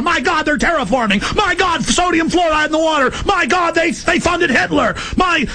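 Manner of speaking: alarmed outcry. Retold or imitated. Host voicing his own alarm to listeners, shouting. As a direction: cry out in alarm at each revelation. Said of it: "I'm going".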